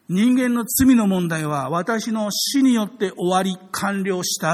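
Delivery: preacher hammering the core message, slow and loud; emphatic preaching. Real, not acted. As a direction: drive the point home from the pulpit. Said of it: "preacher stressing key point".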